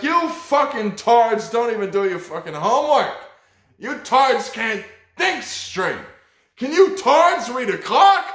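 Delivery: high, loud mock teacher rant; angry teacher shouting. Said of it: "He's like".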